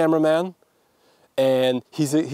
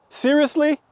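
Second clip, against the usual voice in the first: much higher, incredulous Seriously?; frustrated rhetorical question; exasperated; 10 semitones higher than usual. Real, not acted. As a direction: toss out Seriously? in disbelief. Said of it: "I can't believe I just missed".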